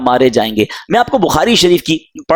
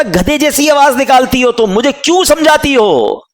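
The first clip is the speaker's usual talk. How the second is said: son's taunt; 9 semitones higher than usual. Retold or imitated. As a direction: sneer it back at the nagging mother. Drunk son taunting his mother.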